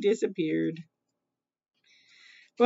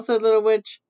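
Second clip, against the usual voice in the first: plain reading voice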